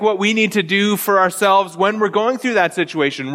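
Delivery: ordinary talk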